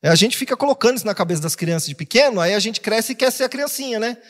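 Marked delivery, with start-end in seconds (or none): none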